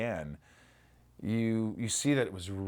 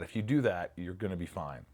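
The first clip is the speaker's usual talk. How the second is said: ordinary delivery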